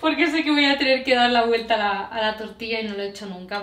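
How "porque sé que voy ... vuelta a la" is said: nervous laugh. Real, not acted. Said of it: "Me estoy poniendo nerviosa".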